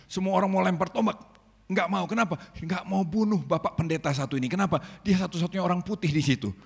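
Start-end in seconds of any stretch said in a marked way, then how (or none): none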